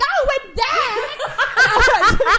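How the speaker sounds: very high, loud, drawn-out mimicry; hammed-up excited shriek; excited interrupting. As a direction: burst in over someone's story. Imitates friend hijacking her story.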